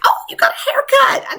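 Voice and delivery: high-pitched, delighted noticing; mock surprise. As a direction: act out spotting a new haircut. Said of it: "I'm like".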